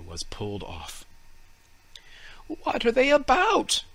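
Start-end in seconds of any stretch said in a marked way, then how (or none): 2.5-3.8 high wondering fir tree voice; puzzled little tree asking itself, voiced high; tree's thoughts. A narrator's reading. Fir tree wonders what the children are doing, high and puzzled. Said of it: "thought the tree".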